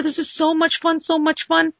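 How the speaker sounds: high, gushing excitement; gushing fan. Retold or imitated. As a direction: gush like a thrilled fan. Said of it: "So he said".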